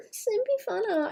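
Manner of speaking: squeaky excited repeat. Shown as a demonstration.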